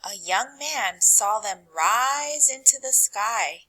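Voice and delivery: usual speaking voice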